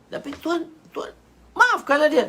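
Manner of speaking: high voice bursting into a loud plea; urgent plea. Acted. Companion begs the Prophet for mercy, high urgent plea. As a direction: break in and beg for mercy.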